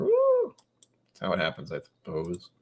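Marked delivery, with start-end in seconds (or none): 0.0-0.6 falsetto woo. Real, not acted. Mock cheer.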